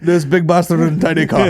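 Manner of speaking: high, loud put-on broken English; hammed-up café regular voice; mock foreign café man. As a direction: size up the newcomer as a comic local. Acts café men's broken English.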